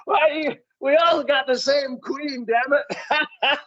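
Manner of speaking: desperate stage plea. Retold or imitated. Comedian retelling a failed joke in Dublin, desperate high plea. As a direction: blurt it out desperately, close to laughter. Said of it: "in desperation, I just, like, said".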